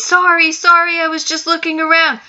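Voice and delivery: high, loud, flustered sorry; frightened apology. Acted. Caught girl apologizes to angry uncle, panicked.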